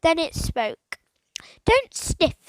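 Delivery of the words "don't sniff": higher, louder bird voice; conversational parrot. A narrator's reading. Parrot speaking to Philip, a touch of squawk. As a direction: chat it like a bird. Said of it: "in a conversational tone".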